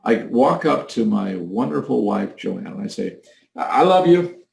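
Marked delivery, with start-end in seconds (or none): 3.5-4.4 high-pitched, stammered declaration; unconvincing. Shown as a demonstration. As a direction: say it with no conviction, voice raised. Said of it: "is that for convincing?".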